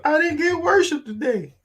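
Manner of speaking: put-on high complaining child voice; imitating kid. Mom imitating her kids complaining, high whiny voice. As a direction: whine it like a kid who missed worship. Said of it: "the kids would be like".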